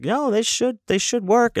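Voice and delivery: high-voiced staff reply. Voicing hotel staff reply.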